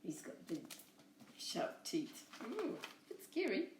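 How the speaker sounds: usual speaking voice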